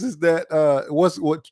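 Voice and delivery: normal talking voice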